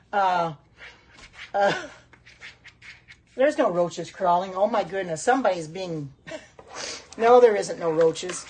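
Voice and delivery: usual speaking voice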